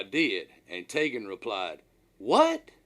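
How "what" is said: sharp, high, loud startled "What?". Retold or imitated. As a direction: blurt it out in disbelief. Deckhand hears peanut butter order.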